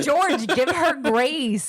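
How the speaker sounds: high, slow, drawn-out reproach; playful scolding. Real, not acted. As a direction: chide a giggling friend, stretching the words. Scolds laughing co-host by name.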